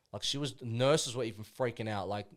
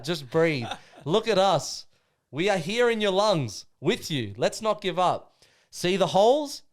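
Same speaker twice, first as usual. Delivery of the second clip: louder, chest-out battle cry; rallying speech; 4 semitones higher, 7 dB louder than usual. Acted. Rousing the brothers with a loud, proud war speech. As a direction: pump up the crew like a war chief.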